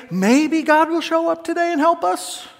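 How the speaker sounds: a hint of a wavering believer; doubtful hoping. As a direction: sound unsure, hoping for rescue. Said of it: "They don't say".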